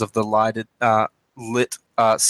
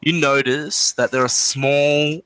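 usual speaking voice

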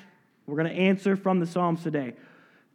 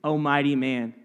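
mocking; 4 semitones lower than usual. Shown as a demonstration. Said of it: "this is said mockingly by David".

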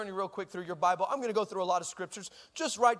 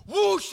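high, loud whoosh of flames; imitated fire whoosh; 10 semitones higher, 6 dB louder than usual. Bigger flame comes out, imitated whoosh. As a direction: let out a big bursting whoosh.

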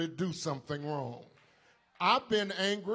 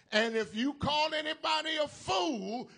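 preacher cries out to the church; preaching shout; 8 semitones higher than usual. Real, not acted. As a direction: lift the voice high on fool. Preacher climaxing on fool.

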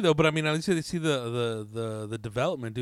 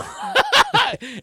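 a high burst of laughter; cracking up high; 5 dB louder than usual. Real, not acted. Friend laughing at the failed set, high burst of laughter.

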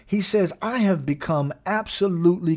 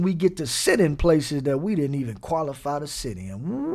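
ordinary delivery

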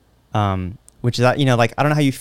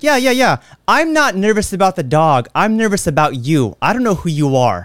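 higher, impatient pushback; sarcastic retort; 6 semitones higher than usual. Retold or imitated. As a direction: brush him off, annoyed. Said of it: "I'm like".

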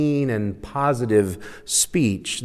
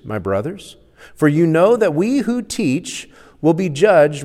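loud, emphatic Bible reading with raised pitch; scripture reading; 3 semitones higher, 6 dB louder than usual. Acted. Preacher reading James chapter three aloud, loud and emphatic. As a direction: proclaim the passage, driving the key words.

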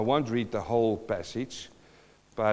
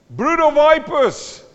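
high, loud prophet's cry of Brood of vipers; shouted rebuke; 14 semitones higher, 11 dB louder, slower than usual. Acted. Preacher voicing John the Baptist, loud shouted rebuke. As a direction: bellow it like a furious prophet.

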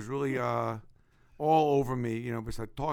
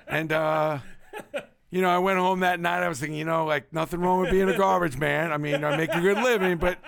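talking loud through laughter; amused, laughing while telling it; 5 semitones higher, 7 dB louder than usual. Real, not acted. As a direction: chuckle your way through the memory. Laughs through garbage man story.

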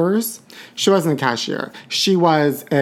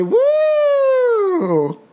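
high whooping cheer; woo. Real, not acted. Host whooping to celebrate Pride with viewers. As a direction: shriek it out with joy.

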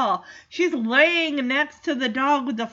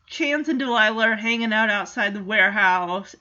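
ordinary talk, nothing marked

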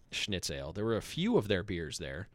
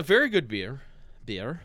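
"Beer." said with a mock German accent, like "pier"; a quick, jokey German accent on "Beer."; 3 semitones higher than usual. Host showing off a German accent about the beer. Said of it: "I'm using my German accent".

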